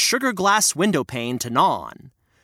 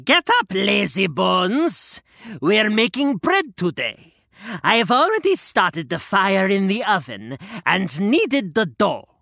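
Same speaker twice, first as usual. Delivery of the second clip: bossy witch; 3 semitones higher than usual. Acted. Witch wakes Gretel to bake, bossy hag. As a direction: order her up.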